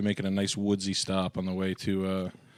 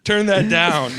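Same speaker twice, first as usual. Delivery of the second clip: yelling; 9 semitones higher, 10 dB louder, much slower than usual. Acted. Mock parent yelling about music.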